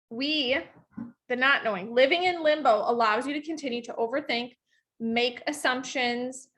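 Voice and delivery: normal talking voice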